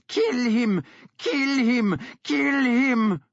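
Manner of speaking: high, loud, repeated frantic scream; frenzied screaming. A narrator's reading. Woman screaming at dogs to kill, frenzied. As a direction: urge the hounds into a frenzy. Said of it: "she screamed at the dogs".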